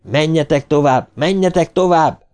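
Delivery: high urgent shout, rising on the repeat. A narrator's reading. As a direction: drive them onward. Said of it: "kiáltotta Ben".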